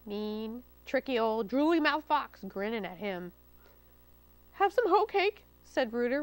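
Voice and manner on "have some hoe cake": high, nervous offering; nervously offer, voice up high; scared. A narrator's reading. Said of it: "real scared".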